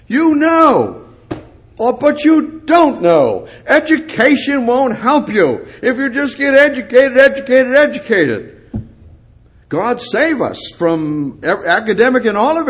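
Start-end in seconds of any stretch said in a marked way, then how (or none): 0.1-8.6 mocking. Mocking the scholar, laughing.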